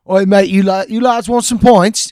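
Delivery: chirpy British geezer hustling strangers; British street guy. Retold or imitated. Comedian imitates a British stranger, mock Cockney accent. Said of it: "a guy walks up and goes".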